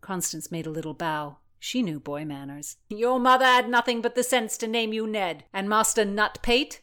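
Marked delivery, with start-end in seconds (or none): 2.9-6.8 loud contemptuous tirade; a loud bullying nobleman; scornful bully. Acted. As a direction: sneer and pile on the insults. Blackjack mocking.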